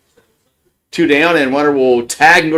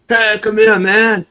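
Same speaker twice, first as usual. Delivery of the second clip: put-on British accent, pitched up and playful; mock British accent; 7 semitones higher than usual. Brits hailing the American fan, mock British accent. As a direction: do the Brits calling you over in a British accent. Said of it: "Come here, mate".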